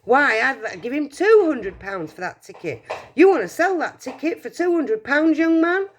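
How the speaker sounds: a woman loudly outbids for the ticket; excited bid. Acted. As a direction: outbid him eagerly.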